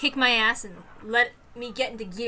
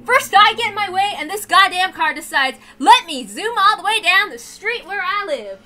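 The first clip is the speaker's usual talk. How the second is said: loud, high, furious ranting at the car; angry rant; 6 semitones higher, 10 dB louder than usual. Real, not acted. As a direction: let the frustration out loud.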